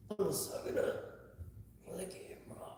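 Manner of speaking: whispered while holding the belly in; voiceless strained whisper; sucking it in. Real, not acted. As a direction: squeeze out the words with the breath held in.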